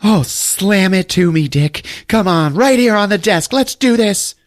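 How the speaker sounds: high, loud hammed-up secretary voice; mock sultry moan. Retelling the show, voicing Dottie's hammed-up passion. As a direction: ham up a breathy office seduction. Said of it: "She's like".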